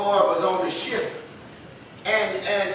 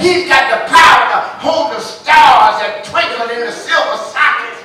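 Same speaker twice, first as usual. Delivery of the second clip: full preaching shout; 2 semitones higher, 8 dB louder than usual. Real, not acted. Preacher shouting God's power to the congregation. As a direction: preach it at the top of your voice.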